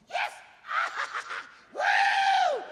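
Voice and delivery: very high squealing cheer and laugh; gleeful running child. Retold or imitated. Coach imitates a little girl running and laughing, gleeful whooping. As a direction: whoop wildly, high and loud, mid-laugh. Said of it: "She's going".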